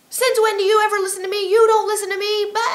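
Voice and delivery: high, loud and indignant; resentful inner voice. Acted. The little voice complaining she never listens, high and loud. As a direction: throw it back like a sulking critic. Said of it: "And the little voice will say".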